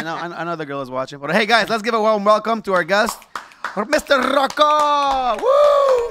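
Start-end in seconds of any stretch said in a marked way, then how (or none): 1.3-5.4 big ring-announcer shout, name stretched out; loud, high build to a long held name; hype announcer. Real, not acted. Host welcomes the guest, shouted announcer build-up. 5.4-6.1 high, piercing "woo!"; loud cheering whoop. Real, not acted. Host cheers the guest's entrance, high shouted whoop.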